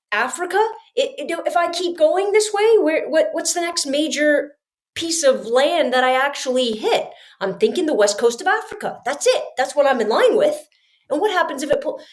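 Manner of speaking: high, racing panic; spiralling thoughts out at sea; panicked inner voice. Retold or imitated. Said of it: "In my head, I'm like".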